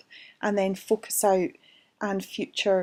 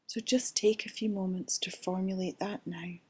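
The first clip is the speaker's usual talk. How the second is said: guided meditation. Acted. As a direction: ease them into calm, gently. Leads a guided relaxation.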